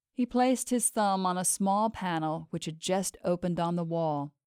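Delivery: ordinary delivery, nothing marked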